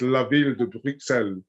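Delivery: La Ville de Bruxelles in a French accent, Bruxelles as brook-SELL. Shown as a demonstration. British gardener shows her French way of saying the rose. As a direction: lean into the French accent on the name. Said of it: "that's where my French accent comes in".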